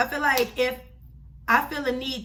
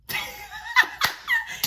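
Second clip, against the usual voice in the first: high burst of laughter. Real, not acted. Laughing at her own story.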